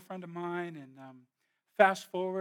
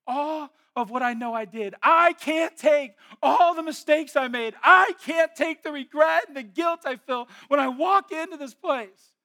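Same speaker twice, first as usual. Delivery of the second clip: anguished plea; 10 semitones higher, 18 dB louder, slower than usual. Retold or imitated. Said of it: "And she goes".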